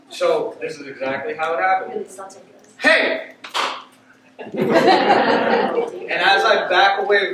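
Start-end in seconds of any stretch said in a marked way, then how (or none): 2.8-3.3 loud, high shout of hey; loud high shout at the robber. Retold or imitated. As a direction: bark it at the robber.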